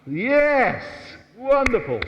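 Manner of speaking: high, loud "Wonderful!"; delighted praise. Real, not acted. Teacher praising the dancing couple, excited and high. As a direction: cheer them on.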